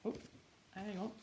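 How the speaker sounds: plain reading voice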